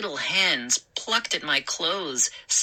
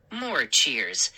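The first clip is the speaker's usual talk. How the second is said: ordinary delivery, nothing marked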